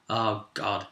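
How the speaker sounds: ordinary talk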